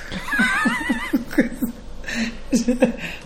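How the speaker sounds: squealing high laugh. Real, not acted. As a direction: shriek with laughter. Laughing at own story.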